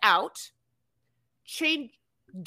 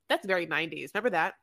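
usual speaking voice

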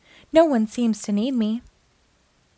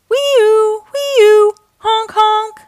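high, sustained siren wail, then honks; imitated siren and horn; 10 semitones higher, 8 dB louder, much slower than usual. A narrator's reading. Fire truck races off to a fire, loud siren imitation.

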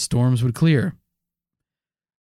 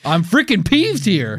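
mock peeved; 6 semitones higher than usual. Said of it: "I can tell".

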